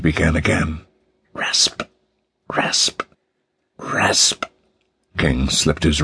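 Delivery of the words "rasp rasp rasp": hushed, spaced-out "rasp"; whispered rasping noise. A narrator's reading. Eerie sound King hears in the dark, whispered rasping. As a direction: whisper it like a saw stroke. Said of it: "yet rasping".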